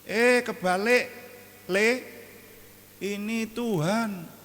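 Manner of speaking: high, loud playful correction; Jesus correcting them. Pretend. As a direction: gently set the scared disciples straight. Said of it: "kalau saya jadi Tuhan Yesus".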